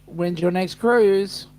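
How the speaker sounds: usual speaking voice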